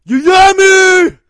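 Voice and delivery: screamed high and loud; shouted name. Acted. Host shouting out his girl Yami, high scream.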